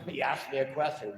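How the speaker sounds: ordinary talk, nothing marked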